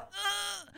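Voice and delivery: quiet pained groan; a small strained ugh of dismay; groan of dread. Retold or imitated. Reacting to dreaded question.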